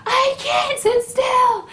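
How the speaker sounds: very high, loud, drawn-out protest; retold desperate yell. Labouring woman answers nurse demanding stillness, high desperate yell. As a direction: re-enact a slow wailed refusal.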